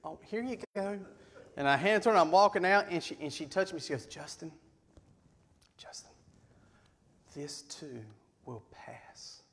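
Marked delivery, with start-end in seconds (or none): 0.0-1.0 small, soft mock-awkward offer; meek. Boyfriend handing cookies to ex's mother, meek and soft. 4.1-9.4 very soft, low, with long pauses; hushed consolation. Retold or imitated. Mother consoling daughter's jilted ex, hushed and solemn.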